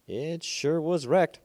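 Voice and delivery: a villager gaping at the ruin. Acted. Villager figure voiced.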